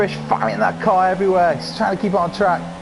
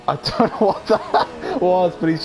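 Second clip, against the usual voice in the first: laughing disbelief. Real, not acted. Stunned by a rival's move.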